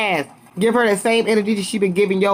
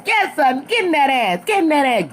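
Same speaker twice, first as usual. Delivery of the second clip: egging on; 7 semitones higher than usual. Real, not acted. Egging Sutton on in fight.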